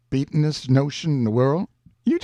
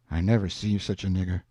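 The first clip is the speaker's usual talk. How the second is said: usual speaking voice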